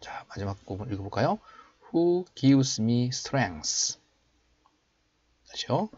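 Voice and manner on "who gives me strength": slow, raised teaching voice; slow model reading. Shown as a demonstration. Teacher showing learners the English verse, slow model reading. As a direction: read it out slowly for learners to copy. Models last part for practice.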